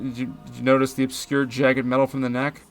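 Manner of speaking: ordinary talk, nothing marked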